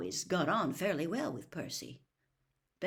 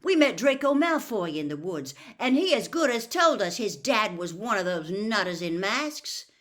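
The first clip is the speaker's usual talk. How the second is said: louder, eager telling; eager accusation; 3 semitones higher, 9 dB louder than usual. Acted. Ron telling his dad about Malfoy, eager. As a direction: rush to make the case.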